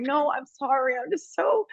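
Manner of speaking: high mock tearful apology; retold crying. Reporter apologizing to the player, mock tearful. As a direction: imitate blubbering, pitch climbing as it goes. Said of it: "I'm just blabbing and crying".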